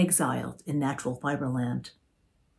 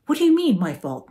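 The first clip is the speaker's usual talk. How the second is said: sharp high outraged question; indignant mother; 5 semitones higher, 7 dB louder than usual. A narrator's reading. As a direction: snap back in the mother's voice. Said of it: "Marge said".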